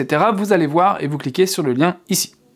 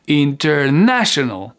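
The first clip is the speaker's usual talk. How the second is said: slow "International", "na" pitched up; higher stressed syllable; 2 semitones lower, slower than usual. Shown as a demonstration. Teacher demonstrating intonation, slow with "na" higher. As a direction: raise the voice on "na" in "International". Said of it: "devenue un peu plus aiguë sur le na".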